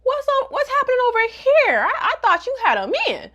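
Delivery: high, loud startled guy voice; hammed-up flustered boyfriend; mock surprised guy. Ryusei startled as his girlfriend's sister comes on to him, hammed-up high voice.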